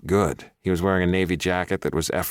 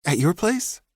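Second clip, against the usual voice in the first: hopefully; 13 semitones higher than usual. A narrator's reading. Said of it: "Jeff asked hopefully".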